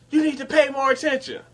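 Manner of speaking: high, fast nagging coworker voice; mock bossy coworker; scolding coworker. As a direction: nag him in her high voice.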